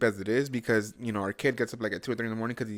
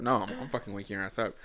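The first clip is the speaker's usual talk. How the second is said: ordinary delivery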